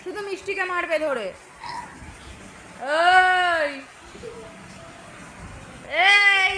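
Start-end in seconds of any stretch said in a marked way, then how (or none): 2.9-6.5 loud drawn-out call. Real, not acted. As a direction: call out to get attention. Calling out sharply to someone. (জোরে ডাক দেওয়া)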